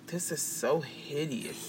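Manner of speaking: low, slow, very soft aside; disgusted aside. Retold or imitated. Assistant standing by while celebrities talk, hushed disgusted aside. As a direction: say it under your breath, slowly. Muttered reaction to overheard talk.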